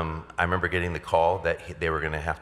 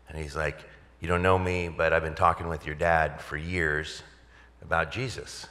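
ordinary delivery

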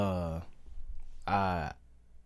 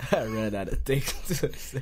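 higher voice broken by real laughter; laughing while talking; 5 semitones higher than usual. Real, not acted. Admitting defeat to co-host, laughing. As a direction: get it out through the giggles.

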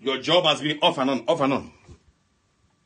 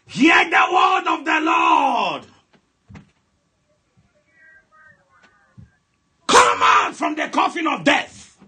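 loud, driving deliverance prayer; fiery preaching; 11 semitones higher, 9 dB louder than usual. Acted. Pastor commanding the congregation's family out of coffins, fiery preaching. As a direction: preach at full force, commanding.

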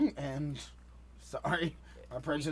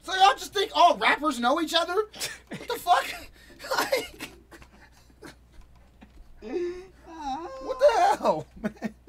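high, cracking laugh-through words; can't get the words out for laughing; laughing incredulously; 13 semitones higher, 4 dB louder, slower than usual. Real, not acted. Laughing at the strangers' guess, high and breaking.

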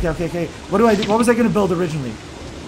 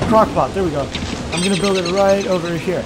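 usual speaking voice

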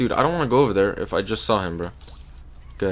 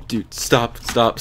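ordinary talk, nothing marked

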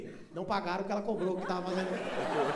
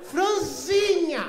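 a slow, loud, drawn-out "Franzinha" shout; mock angry shout; 7 semitones higher, 7 dB louder, much slower than usual. Portuguese clerk correcting him, a slow loud angry shout. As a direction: shout "Franzinha" slowly, fed up. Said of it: "eles vão ficando bravos".

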